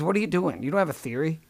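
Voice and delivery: ordinary delivery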